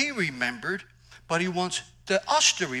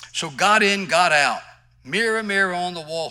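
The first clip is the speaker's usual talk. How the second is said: loud, raised proclamation; preaching shout; 5 semitones higher, 13 dB louder than usual. Acted. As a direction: call it out to the room.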